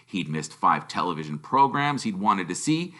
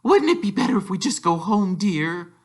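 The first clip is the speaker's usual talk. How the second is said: timid suggestion; 9 semitones higher than usual. A narrator's reading. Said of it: "Aunt Petunia suggested timidly".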